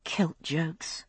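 whispered, exasperated mutter; muttered aside. Acted. Brianna grumbling at Roger's joke, whispered mutter. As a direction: breathe it out under your breath. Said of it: "she muttered".

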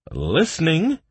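keen, high-pitched reply; livening up. A narrator's reading. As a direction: perk up with sudden interest. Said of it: "livening up at the word million".

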